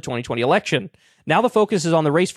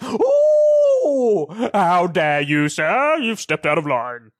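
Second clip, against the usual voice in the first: prissy mock outrage; 2 semitones higher, much slower than usual. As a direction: ham up a prissy how dare you. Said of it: "they're like".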